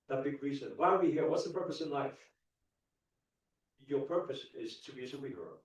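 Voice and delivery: ordinary delivery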